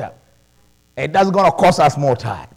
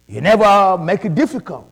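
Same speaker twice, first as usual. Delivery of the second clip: ordinary delivery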